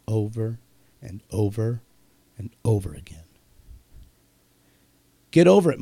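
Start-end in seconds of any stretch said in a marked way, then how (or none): 0.1-3.2 low and soft, sinking into a whisper; fading repetition. Real, not acted. Host warning listeners about endless turmoil, fading to a whisper. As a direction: drop lower with each repeat, pausing between, ending whispered.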